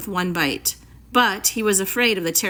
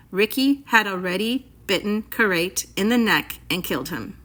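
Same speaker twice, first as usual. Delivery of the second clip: ordinary delivery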